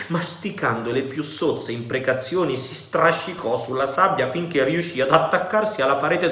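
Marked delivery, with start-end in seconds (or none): none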